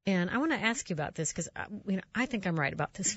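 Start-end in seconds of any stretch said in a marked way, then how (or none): none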